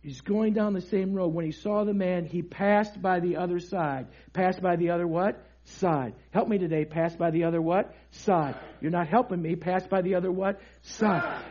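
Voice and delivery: low, soft, leading the congregation's response; quiet teaching. Real, not acted. Preacher reading the verse with the congregation, hushed. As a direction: drop the voice and prompt them.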